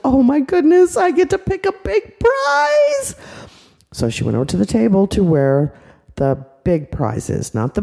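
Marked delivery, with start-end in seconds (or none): 0.1-3.2 put-on thrilled little girl. Little girl delighted she gets a big prize, high and gushing. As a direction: gush like a little kid winning. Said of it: "She's like".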